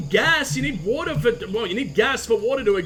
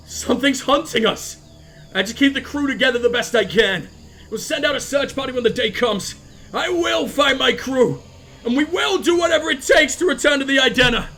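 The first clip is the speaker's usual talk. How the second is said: rising to a shout; 5 semitones higher, 5 dB louder than usual. Acted. Log builds to a vow.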